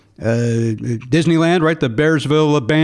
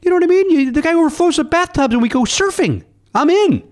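high, gushing enthusiasm; giddy, all in; excited; 12 semitones higher than usual. Real, not acted. Host raving to Matt about Paddington, high excitement.

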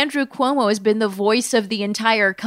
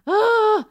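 high, sharp intake of breath; high-pitched gasp; loud gasp; 12 semitones higher, 3 dB louder than usual. Real, not acted. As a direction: gasp after a verbal stumble. Gasp after tripping over words.

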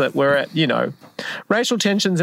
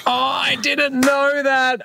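shocked; 9 semitones higher, much slower than usual. Real, not acted. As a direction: cry out in real disbelief. Shocked by news.

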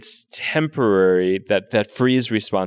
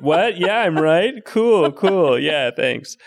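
high, louder mock call to a famous author; pretend phone call; 10 semitones higher, 4 dB louder than usual. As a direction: act out calling her on the phone, high and playful.

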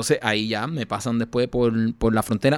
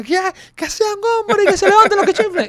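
high, loud furious officer yell; angry shout; 10 dB louder than usual. Retold or imitated. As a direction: bellow the challenge, red with rage. Officer challenging the detainees. (grito furioso del oficial)

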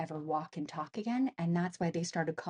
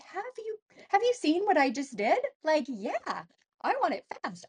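high, indignant comeback; incredulous retort; 8 semitones higher than usual. Retold or imitated. Woman answering the voice in her head, incredulous retort. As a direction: push back, pitched well up, sure of yourself.

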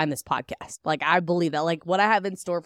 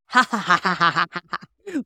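maniacal laugh; 4 dB louder than usual. Shown as a demonstration. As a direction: cackle like an unhinged villain. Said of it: "Like the maniacal laugh".

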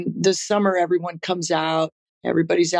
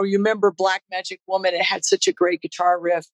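usual speaking voice

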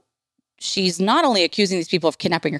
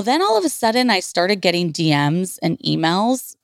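ordinary talk

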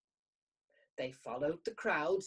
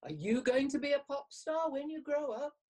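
beaming with joy; 8 semitones higher than usual. A narrator's reading. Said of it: "he beamed".

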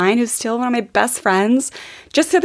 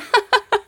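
high burst of laughter; short, high giggle; 11 semitones higher than usual. Real, not acted. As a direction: laugh mid-sentence. Breaks up during the thanks.

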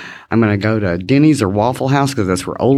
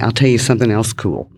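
ordinary talk

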